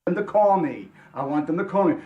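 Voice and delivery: ordinary talk, nothing marked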